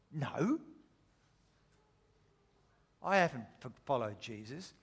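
soft, faltering denial, dropping low; hesitant denial. Acted. Peter denies following Jesus to his accuser, hesitant denial. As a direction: deny it haltingly, trailing down quietly.

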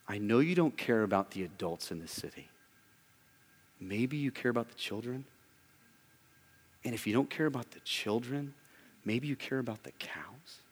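quiet to whispered, gently probing; preacher voicing God, hushed; soft God voice. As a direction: let God's question sink to a whisper.

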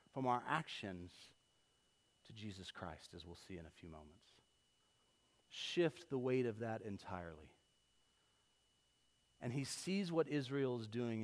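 usual speaking voice

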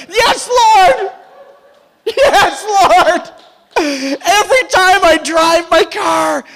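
overflowing joy, stretching the key words slowly; ecstatic excitement. Real, not acted. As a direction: shout it out, thrilled and laughing. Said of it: "I got all excited".

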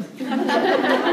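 a quick real laugh with little voice; breathy laugh at her own joke; real burst of laughter. Real, not acted.

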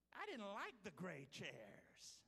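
slow, drawn-out mock complaint; fickle crybaby. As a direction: put on a crybaby churchgoer's voice. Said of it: "is the voice of the fickle".

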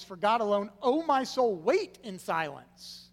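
loud, emphatic command to your own soul; forceful scripture reading, slowing on "wait"; self-command. Shown as a demonstration. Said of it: "he has to command himself to do it".

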